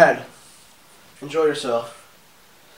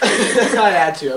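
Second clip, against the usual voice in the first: laughing; 5 semitones higher, 3 dB louder, much slower than usual. Real, not acted. Laughing through the hot wing.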